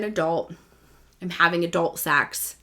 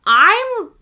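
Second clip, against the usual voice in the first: mock switch back; 12 semitones higher, 8 dB louder than usual. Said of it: "now being me again".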